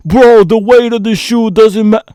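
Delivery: high, loud donkey-from-the-peanut-gallery voice; mock heckler. Reviewer mimicking a peanut-gallery critic, high and loud mock voice. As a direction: pitch it up loudly to mock a clueless critic. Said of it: "some complete donkey from the peanut gallery is gonna say".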